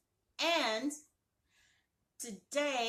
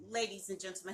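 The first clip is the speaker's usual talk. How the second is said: ordinary delivery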